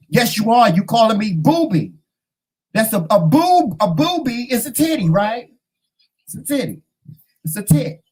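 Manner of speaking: high-pitched insistent comeback; mock indignant arguing. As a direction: argue back in playful outrage. Retold quarrel over nickname.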